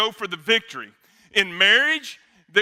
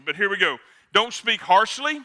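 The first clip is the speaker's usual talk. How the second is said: usual speaking voice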